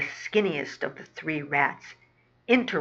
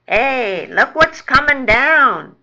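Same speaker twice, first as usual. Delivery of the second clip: loud raised shout; calling out; 6 semitones higher, 10 dB louder than usual. Acted. Rat spots something floating down the sewer, calling out. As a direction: holler it to your pals down the tunnel.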